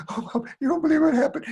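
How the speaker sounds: high, fast, breathless-to-tell rush; excited child. Acted. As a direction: blurt out the big news to mom. Kids excitedly tell mom.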